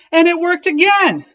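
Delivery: very high, loud cheer. A narrator's reading. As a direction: celebrate Clark loudly. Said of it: "said his friends".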